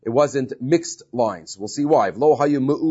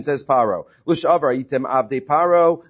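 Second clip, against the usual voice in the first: usual speaking voice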